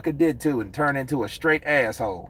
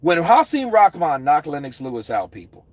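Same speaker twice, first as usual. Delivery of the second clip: ordinary talk, nothing marked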